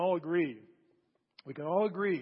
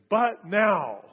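"But now" punched loud; stress on but now; 3 semitones higher, 9 dB louder than usual. Acted. Preacher reading the verse, punching "But now". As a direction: hit "But" hard then drop on "now". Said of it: "this verse begins".

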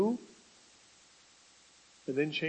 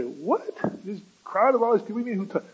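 higher, faster, louder disbelief; incredulous disciples; 5 semitones higher, 4 dB louder, faster than usual. Acted. Disciples answering Jesus in quick, raised disbelief. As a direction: blurt it out in disbelief.

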